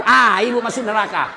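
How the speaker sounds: ordinary talk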